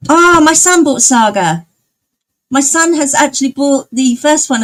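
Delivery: excited mum sharing news. Real, not acted. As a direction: blurt it out, thrilled. Excited news about her son.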